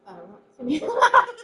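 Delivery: laughing it out, slow and high; laughing refusal. Real, not acted. Laughing as a friend asks her to try again. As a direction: giggle through the refusal.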